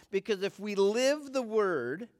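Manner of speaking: usual speaking voice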